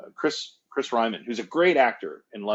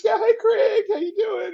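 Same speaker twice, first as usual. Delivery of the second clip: very high, loud put-on greeting; squealed imitation of an excited wife; friend's wife; 7 dB louder than usual. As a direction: call out hello in a shrieky falsetto. Voicing the wife's greeting.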